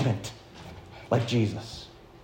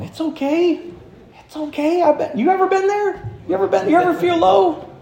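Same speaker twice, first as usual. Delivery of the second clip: gentle comfort; 13 semitones higher than usual. Retold or imitated. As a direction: comfort the disappointed son. Father comforting son.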